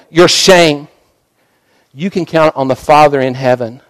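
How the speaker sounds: usual speaking voice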